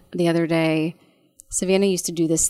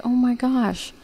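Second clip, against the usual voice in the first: higher, mock-stunned gasp of words; a touch of retold shock; mother realizing what it means; dawning realization; 6 semitones higher, slower than usual. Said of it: "And I'm like".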